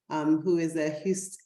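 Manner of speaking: ordinary talk, nothing marked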